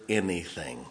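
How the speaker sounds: usual speaking voice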